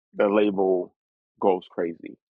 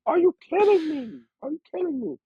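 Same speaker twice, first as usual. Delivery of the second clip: [name] amazed. Retold or imitated. [name] hearing the song for the first time, shrieking high in amazement. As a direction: blurt it out, blown away. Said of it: "and he's like".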